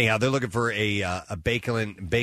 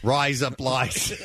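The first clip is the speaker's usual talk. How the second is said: "Rise up lights" slow, Australian, laughing through it; slow Australian accent; 2 semitones higher, much slower than usual. Shown as a demonstration. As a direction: drag "Rise up lights" out in an Aussie voice while laughing. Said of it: "saying in Australian".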